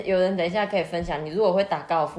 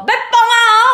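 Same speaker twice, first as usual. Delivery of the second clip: high, very loud street call; vendor's warning yell; 14 dB louder, faster than usual. Retold or imitated. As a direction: holler a warning before the blast. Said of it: "他一定都会说".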